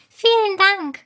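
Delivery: high, bright fairy thanks; squeaky fairy voice. A narrator's reading. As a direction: chirp it out like a tiny fairy. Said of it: "sagt sie".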